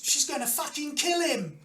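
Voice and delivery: high-pitched mock scream; crowd member's scream. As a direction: cry out high from the stands. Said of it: "someone else screams".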